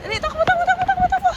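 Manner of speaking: high excited yelling; excited shouting. Real, not acted. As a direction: urge them to run, fast. Urging to run, shouting.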